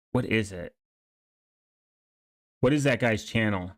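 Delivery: ordinary talk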